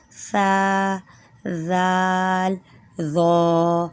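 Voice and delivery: سا زال ذو with tongue between teeth, softly; gentle letter demo. Shown as a demonstration. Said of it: "تھوڑی سی زبان نکالیے اور نرمی سے ادا کرنا ہے".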